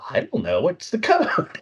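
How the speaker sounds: high, laughing "what's the code"; laughing disbelief. Retold or imitated. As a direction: squeak it out through a laugh. Retold confused reply, laughing.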